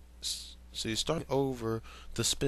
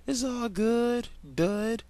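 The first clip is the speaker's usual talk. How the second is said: put-on high, slow, cheery kid voice; smiling boy; 11 semitones higher, 3 dB louder, slower than usual. Steven reassuring Amethyst, smiling in a high slow voice. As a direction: beam it out slowly, high and sweet. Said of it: "he smiled".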